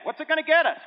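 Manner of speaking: a disciple keen on the perks; mock eager disciple.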